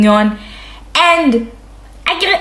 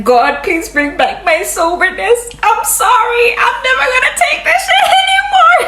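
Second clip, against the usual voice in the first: rising, higher, laughing-crying plea to God; desperate plea; 13 semitones higher than usual. Retold or imitated. Alone on the bathroom floor begging God to be sober, wailing higher and higher. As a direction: beg God for sobriety, voice climbing to a laughing cry.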